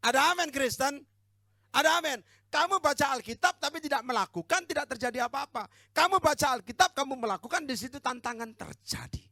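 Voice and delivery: loud, high, emphatic preaching; full-force preaching. Real, not acted. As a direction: drive the point home to the congregation.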